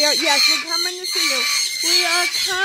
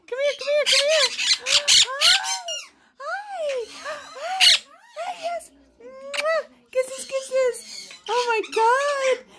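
excited pet talk; 9 semitones higher than usual. Real, not acted. Owner greeting an overexcited bird, squealing with delight. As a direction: gush high and delighted to a pet.

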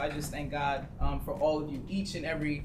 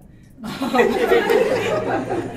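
loud breathy burst of laughter; 9 semitones higher, 14 dB louder than usual. Real, not acted. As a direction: laugh out loud, caught off guard. Laughs after teammate's interruption.

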